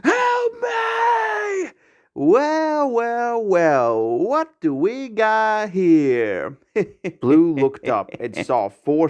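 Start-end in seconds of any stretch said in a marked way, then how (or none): 0.0-1.7 high held desperate shout; cry for help. A narrator's reading. Blue collapsed on the ground, crying for help. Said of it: "and cried". 2.1-7.2 high, slow taunting drawl; mock the fallen bird; sneering bully. A narrator's reading. Scarlet macaw gang leader taunting Blue, sneering.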